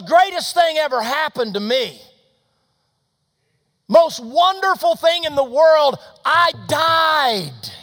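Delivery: high, shouted jubilation; exultant. Retold or imitated. Girl celebrates that she died, exultant. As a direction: proclaim it at full voice, stretching the phrases.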